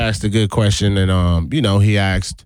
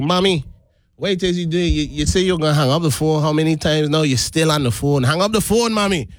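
put-on exasperated grown child in a Guyanese lilt; sing-song Guyanese home dialect; Guyanese accent; 5 semitones higher than usual. Shown as a demonstration. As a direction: plead with mother in a quick, higher Guyanese accent. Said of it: "I talking like this".

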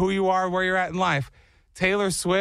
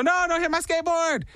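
mock panic; 12 semitones higher than usual. Retold panicked call to the street.

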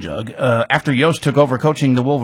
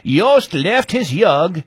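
put-on slow, high Scandinavian-accented line; thick Scandinavian accent; 5 semitones higher, 3 dB louder, much slower than usual. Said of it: "declared in a thick Scandinavian accent".

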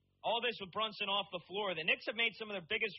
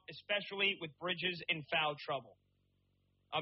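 ordinary talk

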